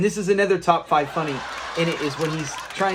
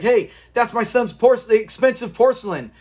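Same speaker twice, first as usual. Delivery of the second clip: higher, emphatic retold call-out; mock confrontation; 5 semitones higher, 3 dB louder than usual. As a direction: call out like catching thieves, half in earnest. [name] retells confronting the intruders.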